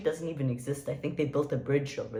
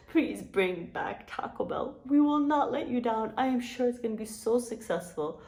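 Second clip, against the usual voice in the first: playful pleading; 6 semitones higher than usual. Pretend. Vlogger begging the chain's owners to bring Taco Bell back, playful pleading. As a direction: beg them in a high, hammed-up plea.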